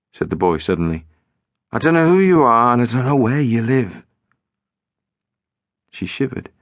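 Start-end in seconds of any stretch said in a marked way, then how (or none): 1.7-4.0 lightly voiced boy, insistent; a hint of the boy on the line; boy's voice. A narrator's reading. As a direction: narrator voicing the boy reassuring her. Boy denies knowing her.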